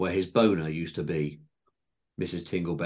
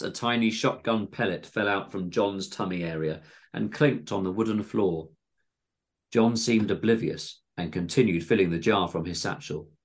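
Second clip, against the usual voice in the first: plain reading voice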